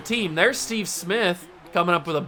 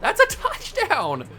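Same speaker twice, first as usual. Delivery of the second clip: outraged yell; 10 semitones higher, slower than usual. Real, not acted. Rage at denied touchdown.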